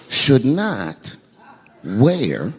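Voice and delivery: normal talking voice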